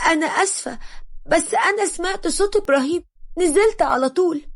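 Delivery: put-on scared girl excusing herself in a rush; nervous apology. Hedia apologizing to the mother, timid and nervous. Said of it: "خافت وقالت بتوتر".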